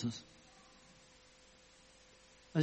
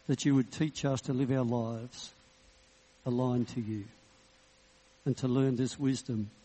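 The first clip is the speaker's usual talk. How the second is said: closing prayer. Acted.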